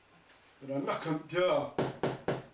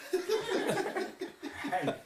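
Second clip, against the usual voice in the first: ordinary delivery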